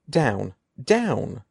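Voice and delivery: ordinary delivery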